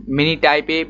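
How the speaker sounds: ordinary delivery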